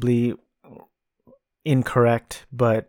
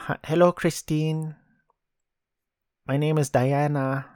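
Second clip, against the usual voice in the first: lightly put-on Filipino accent, pitched up a little; 3 semitones higher than usual. Movie fan doing a line to his Filipino wife, light Filipino accent. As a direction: imitate the film character with a hint of Filipino accent. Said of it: "can't do the accent".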